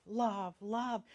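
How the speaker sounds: normal talking voice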